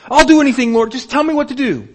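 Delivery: high, loud, desperate eagerness; eager pleading. Acted. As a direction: grab at any instruction. Jack eager to obey.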